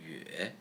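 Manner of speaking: yue dipping low and soft, then up; third tone. Shown as a demonstration. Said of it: "For tones".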